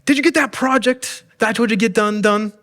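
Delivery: high, sharp demand from an annoyed spouse; mock spouse yelling across the house; nagging spouse yell. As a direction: call out like an impatient spouse from the next room. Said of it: "you hear your spouse yell".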